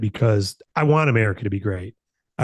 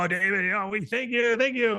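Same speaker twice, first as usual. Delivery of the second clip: much higher, gushing mock thanks; mock fawning praise; 10 semitones higher, 3 dB quieter than usual. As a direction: parody a fawning fan thanking the boss. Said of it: "I don't need you to praise and go".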